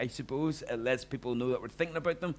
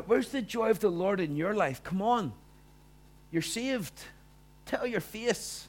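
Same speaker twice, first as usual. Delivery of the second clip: mock a preachy believer telling you to smile; 10 semitones higher, 4 dB louder than usual.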